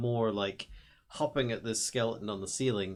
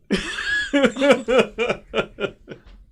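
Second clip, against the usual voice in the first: loud, high burst of laughter; hearty laugh; 14 semitones higher, 13 dB louder than usual. Real, not acted. Player cracks up at a table joke, loud real laughter.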